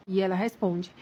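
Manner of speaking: ordinary talk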